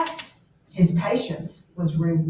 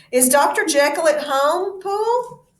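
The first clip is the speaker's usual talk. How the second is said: lawyer asks servant; 7 semitones higher, 4 dB louder than usual. Acted. Lawyer calling to servant Poole.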